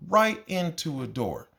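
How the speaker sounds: drawn out punchline; slow it down for the punchline; slow emphatic repeat. Acted.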